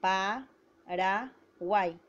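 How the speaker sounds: Paraguay stretched very slowly into pa-ra-guay; syllable by syllable. Shown as a demonstration. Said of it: "vamos a silabiar".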